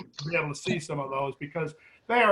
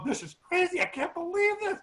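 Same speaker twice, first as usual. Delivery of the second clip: much higher, breathless disbelief; freaking out; 12 semitones higher than usual. Retold or imitated. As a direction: voice her rising panic of wonder. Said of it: "Denise is going freaky".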